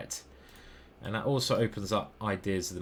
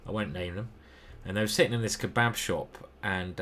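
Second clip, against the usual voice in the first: normal talking voice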